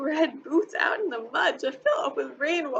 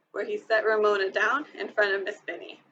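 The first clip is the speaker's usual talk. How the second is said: ordinary delivery, nothing marked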